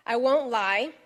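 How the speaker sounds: ordinary talk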